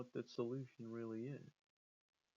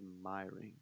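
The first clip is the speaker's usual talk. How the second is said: "My" set down firmly, lower than the rejected words; stress on MY; 3 semitones lower than usual. Shown as a demonstration. Fan confirming Roman's exact wording, firm stress on "My". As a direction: settle on "My" as the true word, deliberately. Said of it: "He said".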